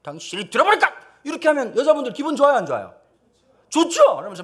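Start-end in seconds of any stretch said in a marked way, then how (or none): 0.0-0.9 loud, heated yell; shout. Pretend. Husband shouting down the noisy neighbor, loud. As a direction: bark it at her hard.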